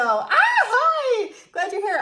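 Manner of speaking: high, loud, laughing hello; excited greeting. Retold or imitated. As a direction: squeal hi with a laugh. Reading a fan's excited greeting.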